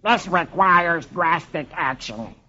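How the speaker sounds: slow, disgusted cartoon duck voice; Donald Duck. Donald fed up with ignored sign, disgusted duck voice. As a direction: announce it slowly as a cartoon duck.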